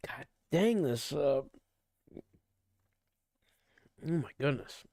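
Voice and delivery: talking through hiccups; words broken by hiccups; hiccuping. Real, not acted. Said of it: "Now I'm just burping and hiccuping nonstop".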